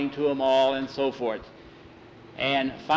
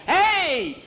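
high-pitched holler; startled, drawn-out shout way up high; outraged call; 4 dB louder than usual. A narrator's reading. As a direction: yell after the runaway ball thief. Players see ball stolen.

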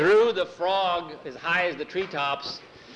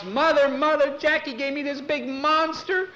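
high panicked scream for mother; 12 semitones higher, 3 dB louder than usual. Acted. Nancy runs in frightened, screaming to her mother. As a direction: shriek it, terrified. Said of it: "just screaming and screaming".

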